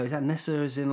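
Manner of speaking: ordinary talk, nothing marked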